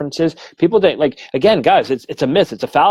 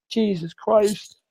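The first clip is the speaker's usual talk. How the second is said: overwhelmed; 9 semitones higher, 4 dB quieter, much slower than usual. Retold or imitated. Sitter overwhelmed in the demo.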